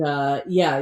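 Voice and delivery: ordinary talk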